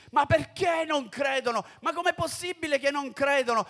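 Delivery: più alto e più forte, esasperato; sfogo esasperato. Acted. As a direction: lamenta l'incredulità altrui. Sfogo dei credenti. (il credente si sfoga sui colleghi, esasperato)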